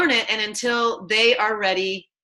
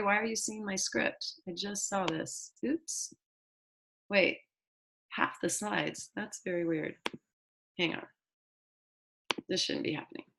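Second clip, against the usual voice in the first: much softer, trailing into a whisper; muttered aside; 12 dB quieter than usual. Real, not acted. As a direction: talk to yourself, away from the mic. Off-mic aside about screen glitch.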